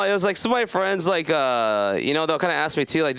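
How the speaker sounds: ordinary talk, nothing marked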